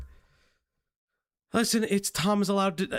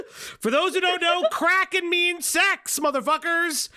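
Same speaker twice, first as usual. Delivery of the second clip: high laughing voice; 10 semitones higher, 3 dB louder than usual. Real, not acted. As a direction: laugh through the words. Laughing at the roleplay.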